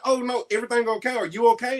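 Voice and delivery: high-pitched imitation of a concerned mom; imitated concern. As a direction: check on them with high, quick mock concern. Retelling mom's concerned check.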